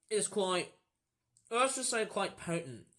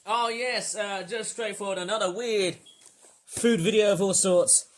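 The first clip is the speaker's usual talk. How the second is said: loud upbeat sign-off; YouTube outro; 3 semitones higher, 8 dB louder than usual. Acted. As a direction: sign off the video to viewers.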